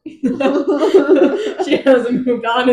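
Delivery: slow, drawn-out words through laughter; laughing tease. Real, not acted. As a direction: tease while still laughing. Laughing while teasing about Mor.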